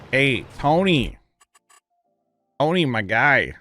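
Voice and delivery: usual speaking voice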